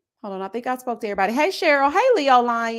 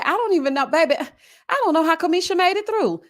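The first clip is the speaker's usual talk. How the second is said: ordinary delivery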